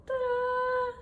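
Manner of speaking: high, bright showy ta-da; ta-da reveal. Acted. Knitter unveiling her test knit to viewers, excited. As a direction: present it like a magic trick.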